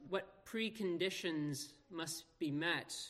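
ordinary talk

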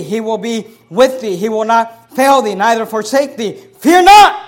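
loud, slow, booming scripture proclaimed to the congregation; preaching at full force. Acted. Pastor proclaims to his congregation, preaching at full force. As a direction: thunder each promise out from the pulpit.

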